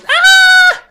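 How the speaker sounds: very high, loud goat yell; goat scream imitation. Mimicking the screaming goat meme, piercing high yell. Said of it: "the goat goes".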